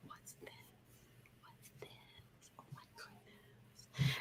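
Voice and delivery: breathless whisper of wonder; gasp, then a curious whisper; intrigued whisper. Shown as a demonstration. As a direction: whisper it like a secret find. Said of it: "I wonder what this is".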